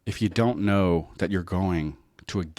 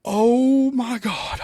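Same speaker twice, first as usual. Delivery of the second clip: very high, loud mock shriek of shock; 8 dB louder, much slower than usual. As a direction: squeal it out in horror. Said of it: "And I'm like".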